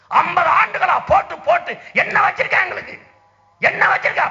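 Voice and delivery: high, loud furious demand hurled at the crowd; angry shout. Real, not acted. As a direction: roar the question in outrage, pitch way up. Angry rally question to voters.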